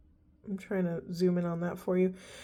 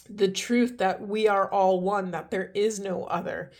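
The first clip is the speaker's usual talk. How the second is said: usual speaking voice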